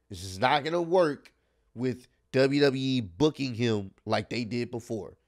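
normal talking voice

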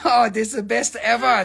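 usual speaking voice

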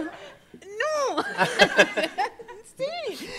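very high, squeaked no dissolving into laughter; squeaky panicked no. Retold or imitated. Mimicking her panic to the instructor, squeaky and high. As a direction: squeal it like a terrified diver.

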